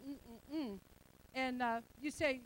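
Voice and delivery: normal talking voice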